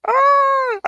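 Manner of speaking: very high, loud wail; frustrated scream. Real, not acted. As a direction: cry out at the end of your rope. Stream still will not connect.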